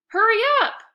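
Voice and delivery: high, loud impatient shout; boy shouting across the park to hurry up. Acted. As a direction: shout to hurry him along. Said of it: "shouted Eric".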